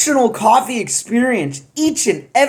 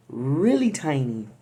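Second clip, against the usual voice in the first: "really tiny" dropped low and soft to sound tiny; "really tiny" said softly and low, as if it were tiny; small quiet voice; 7 semitones lower, 8 dB quieter than usual. Shown as a demonstration. Shrinking size of the batches.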